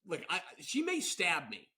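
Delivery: ordinary talk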